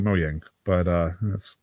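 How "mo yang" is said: MOJANG said mo-yang; habitual pronunciation. Shown as a demonstration. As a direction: say Mojang with a y sound for the j. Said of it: "I always called it Mojang".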